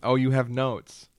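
usual speaking voice

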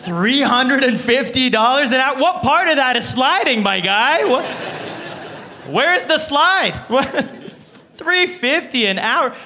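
high, loud disbelief with laughter; can't believe the price; incredulous. Real, not acted. Ranting at the therapist's fee, incredulous.

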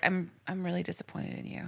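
soft, quiet disappointed mom; a quiet disappointed mother voice; mom disappointment. Pretend. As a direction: let them down gently like a mom. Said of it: "Just straight up mom disappointment".

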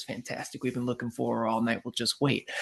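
soft, quick, hushed. Retold or imitated. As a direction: keep it low, don't tip her off. Paparazzo keeping low near store.

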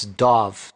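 "Dov" said low and slow, rhyming with "love"; name pronunciation. Shown as a demonstration. Host tells the audience how to say Dov, slow and low. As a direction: spell it out, then say "Dov" slowly and clearly. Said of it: "Your name is spelled for the audience D O V, but it's".

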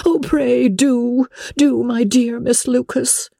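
high, slow, mournful pleading; melancholy plea. A narrator's reading. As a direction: beg her friend with a sigh in the voice. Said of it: "in a melancholy tone".

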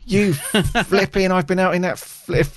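high, slow nerdy outburst; Moss voice. Retold or imitated. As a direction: squeak it like an awkward IT nerd. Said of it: "I sound like Moss from the I T crowd".

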